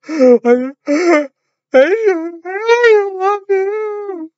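high, wailing, very slow "I love you"; stuttering, crying falsetto love plea; mock sobbing. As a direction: ham up a tearful confession. Acts out a crying declaration.

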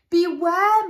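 a mother's sharp loud call of warning; loud high warning cry. Acted. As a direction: call out the danger to the child. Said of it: "The dinosaur mum said".

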